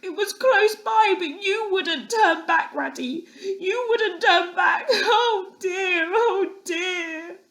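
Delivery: high, far-off little voice, half laughing, half sobbing; a small animal choking on tears and memories; tearful Mole. A narrator's reading. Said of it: "fresh waves of sorrow and sobs".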